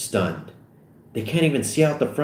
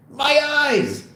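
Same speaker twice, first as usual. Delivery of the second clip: very high, drawn cry; high-pitched comic shriek; pained yell; 3 dB louder than usual. Acted. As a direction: cry out as if blinded. Blinded driver cries out.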